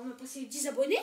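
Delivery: ordinary talk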